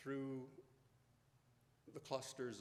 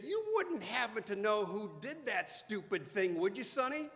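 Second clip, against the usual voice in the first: higher, louder, knowing taunt; sarcastic firefighter, raised and higher; firefighter teasing; 8 semitones higher, 8 dB louder than usual. Retold or imitated. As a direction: lean in nose to nose and needle the kid. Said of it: "nose to nose with me, and he said".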